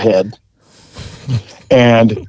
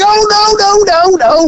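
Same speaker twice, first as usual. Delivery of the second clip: high, squeaky mock denial; hillbilly denial. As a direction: squeal out the protest. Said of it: "he's like".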